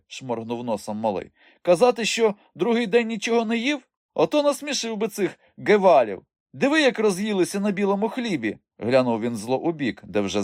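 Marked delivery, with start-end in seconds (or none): 1.6-8.6 high, loud, cheeky retort; defensive young rogue. A narrator's reading. Boy defending himself to uncle, defiant. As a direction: protest in a boy's high voice.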